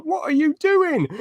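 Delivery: high, slow incredulous question; mock bafflement. Fan imitating Cameron who doesn't know, high baffled question. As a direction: draw it out high in imitated disbelief.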